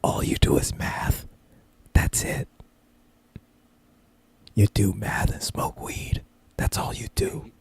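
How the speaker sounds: slow, voiceless whisper; whispered put-down. Acted. Smart speaker mocking its owner's life, slow whisper. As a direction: whisper it slowly, as the judging device.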